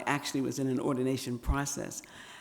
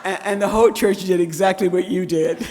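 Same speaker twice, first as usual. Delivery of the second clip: louder, laughing along with the church; sharing the laugh; 5 semitones higher, 10 dB louder than usual. Real, not acted. Laughing with congregation.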